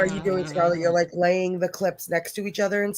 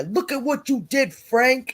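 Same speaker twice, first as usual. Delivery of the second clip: scolding Frank in a higher, put-on voice; pitched-up mock telling-off; mock accusing; 6 semitones higher than usual. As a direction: act out a raised-voice accusation. Acting out accusing line to Frank.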